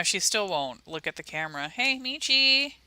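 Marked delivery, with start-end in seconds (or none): 1.7-2.7 calling the dog. Real, not acted.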